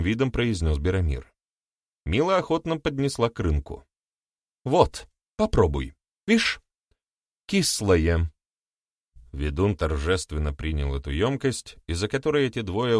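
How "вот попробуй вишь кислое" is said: insistent. Acted. Woman insists milk is sour.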